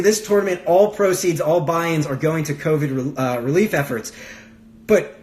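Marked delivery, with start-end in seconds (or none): none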